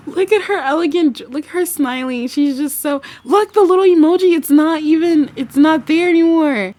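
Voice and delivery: high, loud, building excitement; excited exclaiming. Real, not acted. Streamer pointing at her smiling Sim, excited.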